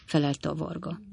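usual speaking voice

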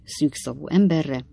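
ordinary delivery, nothing marked

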